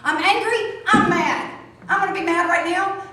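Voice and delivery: mock anger demo. Shown as a demonstration. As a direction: show anger letting it out. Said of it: "feel your emotions and be authentic".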